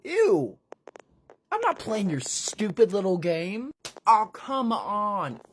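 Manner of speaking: high, loud whiny refusal; squeaky disgusted hero. Acted. Chris high voice.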